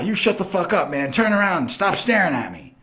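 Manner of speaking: high, agitated bully imitation; mock a riled bully ordering someone around; pissed-off bully. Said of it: "he's all pissed".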